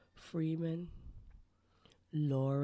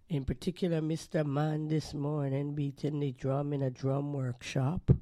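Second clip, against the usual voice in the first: usual speaking voice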